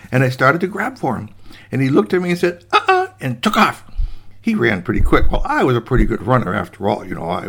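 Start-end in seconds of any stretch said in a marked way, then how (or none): 2.7-3.1 tiny high-pitched refusal; cheeky gingerbread boy. Acted. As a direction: squeak a teasing no. Cheeky cookie refuses and runs.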